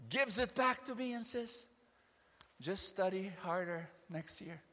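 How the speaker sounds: loud, high sermon delivery; fiery preaching. Acted. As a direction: drive the scripture home with fire. Preaching at full force.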